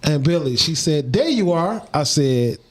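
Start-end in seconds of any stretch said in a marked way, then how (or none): 1.1-1.9 aunt on the phone. Retold or imitated.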